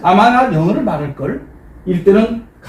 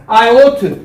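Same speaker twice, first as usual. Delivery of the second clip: call-out to class; 3 semitones higher, 4 dB louder than usual. Shown as a demonstration. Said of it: "왼손 오른손 하세요".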